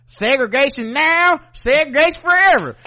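high, loud segregationist rallying cry; mock hateful speech, loud and high. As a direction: declaim it like a Southern bigot. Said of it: "sound like he hate".

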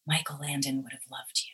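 low, soft murmur in the ear; leaned-in murmur. Retold or imitated. Co-star leaning in before action, soft murmur. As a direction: lean in and murmur it close. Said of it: "she leaned into me and said".

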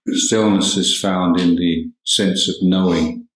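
ordinary delivery

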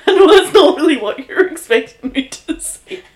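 loud, high talk through laughter; laughing story; laughing while talking. Real, not acted. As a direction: keep laughing through the words.

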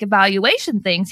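ordinary talk

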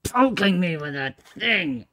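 annoyed character snapping; fed-up fighter being jabbed; exasperated poked game character. Acted. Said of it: "And say".

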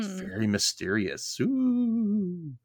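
soft, slow "very mysterious", then a high ghostly ooh; mock mysterious. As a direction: play up the spooky mystery, hushed. Mock spooky comment on title.

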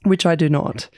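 ordinary talk, nothing marked

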